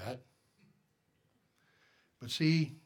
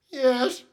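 pained yes; 10 semitones higher, 10 dB louder than usual. Retold or imitated. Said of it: "it's like".

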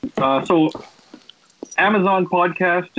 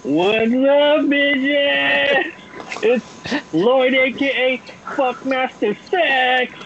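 higher hyped wrestler intro; wrestling gimmick; 8 semitones higher, much slower than usual. Acted. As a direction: belt out a cocky wrestling alias. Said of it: "following a, like, wrestling gimmick".

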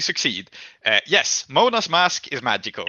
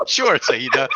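much higher and louder, laughing through the words; laughing disbelief; 5 semitones higher, 7 dB louder than usual. Real, not acted. GM laughing in disbelief at Saida bending time. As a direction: crack up at the player's audacity.

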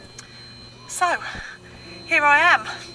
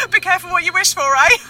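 laughing; 14 semitones higher, 6 dB louder, much faster than usual. Acted. Laughing at own joke.

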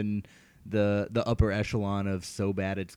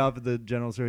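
usual speaking voice

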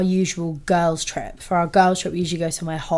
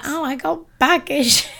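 higher, slower whiny mock complaint; mocking her friend; 7 semitones higher than usual. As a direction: imitate the friend's fussy excuse. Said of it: "And she was like".

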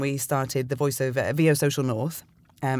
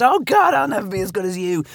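mock despair; 8 semitones higher, 7 dB louder than usual. Retold crisis of confidence thought.

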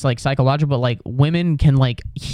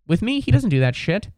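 ordinary talk, nothing marked

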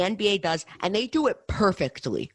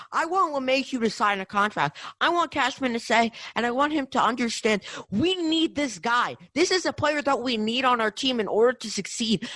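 higher, heated fan rant; pissed-off rant; 7 semitones higher than usual. Real, not acted. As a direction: vent real frustration, pitch up. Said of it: "I'm pissed off".

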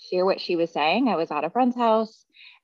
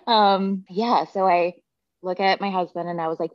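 ordinary delivery

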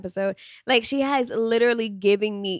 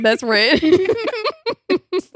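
laughing; 9 semitones higher, 4 dB louder, slower than usual. Real, not acted. Joking about her best friend.

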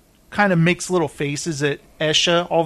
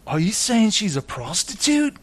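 imitated Liverpudlian, pitched up; light mock Liverpool accent; 4 semitones higher than usual. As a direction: put on a Scouse voice, incredulous. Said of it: "'cause he's from Liverpool. I love his voice".

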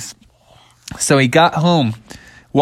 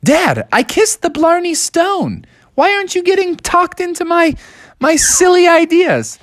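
indignant Bubby. Acted. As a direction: squeak out the complaint. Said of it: "And Bubby was like".

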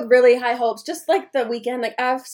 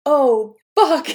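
mock panic; 3 semitones higher, 6 dB louder than usual. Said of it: "and I'm just like".

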